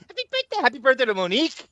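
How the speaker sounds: put-on high, cooing baby talk. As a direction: coo it like a baby. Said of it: "The baby voice comes in".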